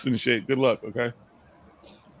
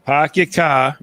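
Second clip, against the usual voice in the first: drawn-out, dropped-R Boston accent; much slower than usual. Shown as a demonstration. Showing the room his own accent, Boston accent. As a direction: show off your Boston accent. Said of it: "I'll show off my accent, okay?".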